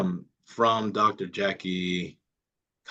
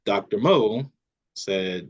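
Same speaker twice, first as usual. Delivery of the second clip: ordinary delivery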